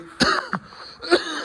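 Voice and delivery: dusty hacking cough; harsh coughing. Real, not acted. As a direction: cough up dust. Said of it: "I gotta cough up all the dust".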